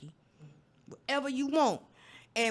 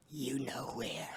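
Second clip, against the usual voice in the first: low hushed whisper; whispered innuendo; 11 semitones lower, 5 dB quieter, slower than usual. Retold or imitated. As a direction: whisper the hint knowingly. Doctor's hinting reply.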